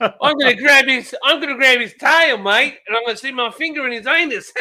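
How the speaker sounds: high, eager Australian accent impression; Steve Irwin. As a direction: play the fearless Aussie croc hunter. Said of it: "it's 'cause we had Steve Irwin".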